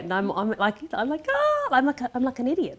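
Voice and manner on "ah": high, loud gleeful "ah"; excited squeal. Retold or imitated. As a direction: squeal like a happy idiot. Acting out her naive happy excitement.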